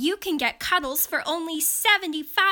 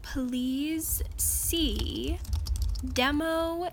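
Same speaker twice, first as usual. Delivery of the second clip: soft voiceover read; 5 dB quieter, slower than usual. Acted. Voiceover reel narration.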